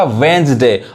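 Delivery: वेडनेसडे as "wens-day"; वेडनेसडे in two syllables, "wenz-de"; silent D. Shown as a demonstration. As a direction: say वेडनेसडे without the first d. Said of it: "डी नहीं पढ़ाएगा".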